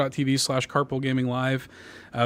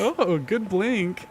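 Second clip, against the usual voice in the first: very slow, higher doting coo; parent cooing; 6 semitones higher, much slower than usual. Retold or imitated. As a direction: praise a blink like a proud parent. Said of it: "like, a parent".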